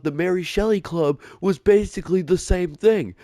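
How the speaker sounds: high, snippy imitation of the girlfriend; mocking girlfriend. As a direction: mimic her defensive confession in a high voice. Girlfriend angrily admits, voiced.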